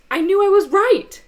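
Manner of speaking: loud, high indignant protest; indignant and insistent, pushed loud; retold angry outburst. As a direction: replay being wronged, voice rising. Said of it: "I was just getting so angry".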